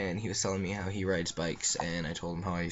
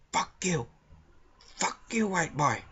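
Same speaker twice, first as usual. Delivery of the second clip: higher-pitched, mocking curse at the cashier; mock angry old man; 10 semitones higher than usual. Old man swearing at the cashier, mock angry.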